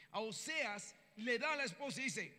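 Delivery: ordinary delivery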